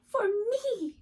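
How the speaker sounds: high, loud squeal of delight; excited cry. Acted. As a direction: squeak it out, thrilled. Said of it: "Rabbit squeaked with delight".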